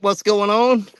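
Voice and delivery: ordinary delivery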